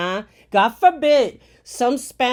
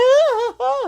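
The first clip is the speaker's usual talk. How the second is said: high, shocked mock gasps; hammed-up scandalized gasping; Laura Ingraham gasping; 5 dB louder than usual. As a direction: clutch your pearls in mock horror. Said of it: "She was like".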